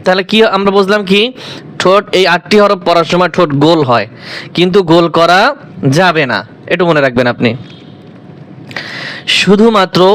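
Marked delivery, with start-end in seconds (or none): none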